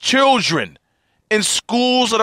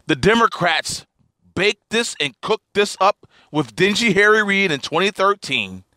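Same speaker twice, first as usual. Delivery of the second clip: ordinary delivery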